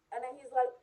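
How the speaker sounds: ordinary talk, nothing marked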